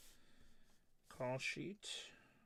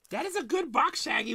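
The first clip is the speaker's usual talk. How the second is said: much higher, louder, in a squeaky mock voice; mock squeaky praise; 11 semitones higher, 8 dB louder than usual. Mock praise to chat viewer.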